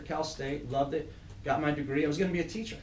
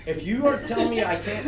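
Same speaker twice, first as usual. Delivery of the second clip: slow, louder, laughing through it; 8 semitones higher, 6 dB louder, slower than usual. Retold or imitated. Dad retelling the hospital standoff, laughing hard. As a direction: stretch it out while cracking up.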